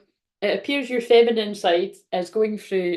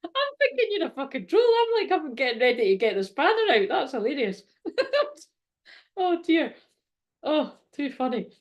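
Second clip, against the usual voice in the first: laughing; 7 semitones higher than usual. Real, not acted. Joking at a troll viewer.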